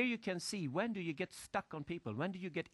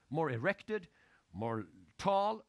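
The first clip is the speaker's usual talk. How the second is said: ordinary delivery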